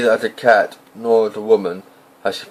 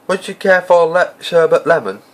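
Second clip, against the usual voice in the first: put-on a genial old wizard offering a sweet, pitched up; friendly offer, voice lifted high; lightly voiced Dumbledore, higher and louder; 8 semitones higher, 3 dB louder than usual.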